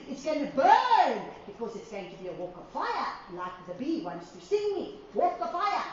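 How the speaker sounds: ordinary talk, nothing marked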